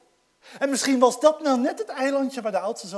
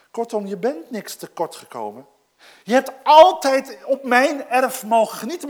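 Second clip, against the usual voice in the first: normal talking voice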